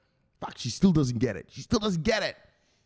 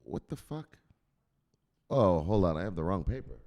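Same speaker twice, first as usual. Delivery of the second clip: ordinary talk, nothing marked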